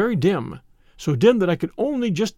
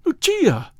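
high-pitched calling of her name; astonished cry; 11 semitones higher, 4 dB louder, much slower than usual. A narrator's reading. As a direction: cry out the beloved's name twice. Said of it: "I cried".

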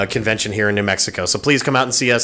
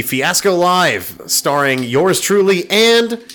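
higher, slow, grand build-up; showman announcer; 8 semitones higher, slower than usual. Acted. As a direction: sell it like a show host. Hyping own show, showman plug.

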